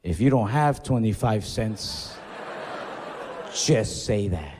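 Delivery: low, slow and snide; a slow low sneer; snide cashier. Retold or imitated. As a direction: drop the voice low, drag out the insult. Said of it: "This is where I lost my temper".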